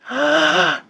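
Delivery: sharp demo breath, high and airy; exaggerated breathing noise for the mic; audible breath demo. Shown as a demonstration. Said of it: "I have to put a sound on it so you can hear it".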